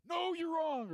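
high, loud mock yell of "No"; hammed-up outraged listener; mock objection. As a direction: play a shrill heckler shouting you down.

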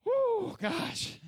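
very high, loud mock sigh of relief; hammed-up phew after a scare; relieved disciples. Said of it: "all the other disciples were like".

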